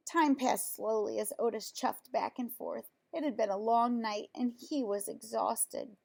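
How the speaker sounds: usual speaking voice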